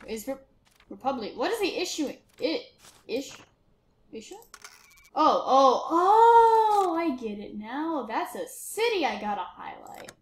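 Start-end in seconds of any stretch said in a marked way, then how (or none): 5.1-7.0 rising, loud drawn-out realisation; sudden realisation. Real, not acted. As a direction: let it dawn, climbing high and loud. Suddenly understands the game.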